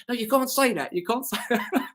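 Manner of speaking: high-pitched, laughing mock scolding; retold protest, cracking up. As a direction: object through your own giggles.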